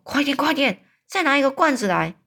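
urgent, high call for another jar; shouting. A narrator's reading. Giant catches a dream and calls for a jar, urgent shout. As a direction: hurry her with a loud cry. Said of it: "他就大叫说".

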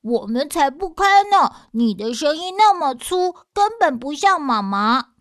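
put-on loud, high shout of kids refusing; defiant children. Children shouting through the door at the fake mother, high and loud.

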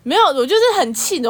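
fired up recalling it; real exasperation; indignant. Real, not acted.

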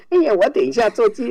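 high falsetto mock mom; man straining to sound female; fake woman voice. Shown as a demonstration. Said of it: "你不要说妈妈说". (男生硬装的女声)

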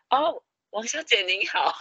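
high, bright polite phone greeting; mock service voice; imitating polite caller. Callers greet him as a woman.

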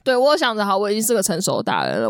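ordinary talk, nothing marked

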